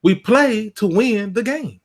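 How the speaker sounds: high, slow, emphatic coach preaching; Herm Edwards voice. Retold or imitated. Host imitating Herm Edwards to his co-hosts, slow and high. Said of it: "let me get my Herm Edwards voice on".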